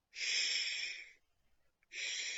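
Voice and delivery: a soft unvoiced sh; sh sound. Shown as a demonstration. Teacher sounding sh for the children. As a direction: hush out a quiet sh. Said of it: "what's that sound".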